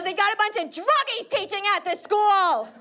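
high, put-on indignant voice; indignant complaint. Telling dad about the druggie teachers, mock indignant. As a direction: complain in a high, exaggerated character voice.

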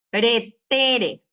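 "pretere" with TE loud and high; stress on middle syllable. Shown as a demonstration. Teacher asks where the voice lands, stressed "TE" in "pretere". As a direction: lean hard on the TE in "pretere". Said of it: "Dónde cargo la voz".

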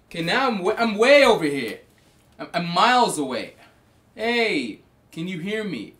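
far from the mic. Real, not acted. Mic test from across the room.

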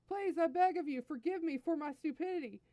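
high, loud pleading; desperate begging. Acted. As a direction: beg for mercy. Nappa begs the prince for forgiveness.

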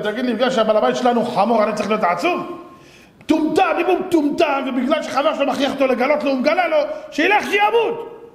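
loud, high-pitched contemptuous rant; scornful retort. Acted. Rooster answering the dog about their foolish master, scornful. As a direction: fire back, scorning the fool.

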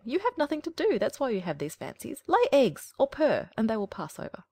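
high, loud bossy hen; scolding hen. Acted. Hen scolding the duckling, high and bossy. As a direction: lecture like a fussy hen who knows best.

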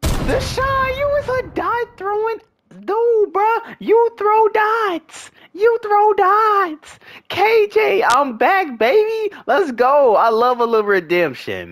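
very high-pitched gleeful squeal; elated praise. Real, not acted. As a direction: hype up your quarterback in falsetto joy. Celebrating a great throw.

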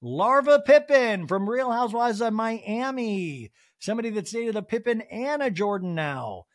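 hammed-up guest announcement; mock announcer. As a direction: stretch the names out like a grand introduction.

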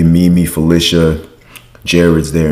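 ordinary talk, nothing marked

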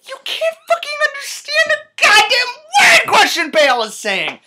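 high-pitched, full-volume rant; exasperated yelling. Real, not acted. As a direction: yell it out in exasperation. Exasperated rant about Bale's garbled voice.

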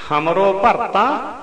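very slow, drawn-out recital of ਹਮਰੋ bharta; slow reverent verse reading of ਹਮਰੋ bharta; scripture recitation. Acted. Verse chanted very slowly.

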